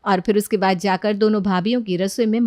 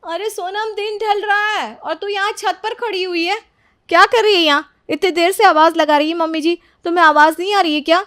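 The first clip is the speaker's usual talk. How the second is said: much higher-pitched scolding voice; younger sister-in-law; 10 semitones higher, 4 dB louder than usual. Acted. Younger sister-in-law catching Sonam on the roof, high-pitched scolding. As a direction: start high, build to a loud interrogation. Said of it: "छोटी भाभी की आवाज़ सुनाई देती है".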